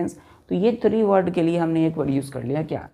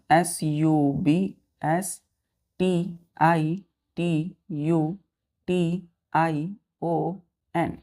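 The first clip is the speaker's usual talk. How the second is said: each letter spaced out slowly for dictation; teacher spelling a word slowly so children write it down; much slower than usual. Shown as a demonstration. Teacher dictating letters to children, slow and spaced. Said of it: "teacher spells word letter by letter".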